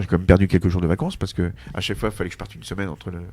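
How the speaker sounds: ordinary delivery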